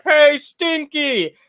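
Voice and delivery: put-on high, very slow old man greeting; Grampy voice. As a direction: drag it out like an old grandpa. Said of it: "Grampy being like".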